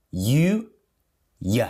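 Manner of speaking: full "You" then weak "ya"; you becomes ya. Shown as a demonstration.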